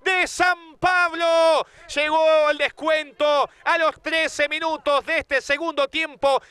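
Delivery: slow, high, jubilant announcement; elated. Real, not acted. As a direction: still riding the goal high. After goal, still elated.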